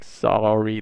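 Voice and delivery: plain reading voice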